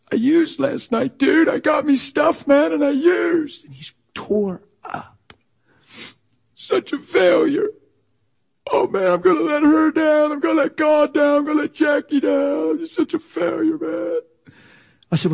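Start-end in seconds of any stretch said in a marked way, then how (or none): 0.1-3.7 high, strained voice of a distraught friend; Todd in despair. Retold or imitated. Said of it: "crying his eyes out". 6.5-14.2 high, strained voice of a distraught friend; Todd in despair. Retold or imitated. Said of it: "crying his eyes out".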